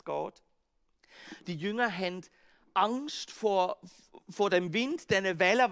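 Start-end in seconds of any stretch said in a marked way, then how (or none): none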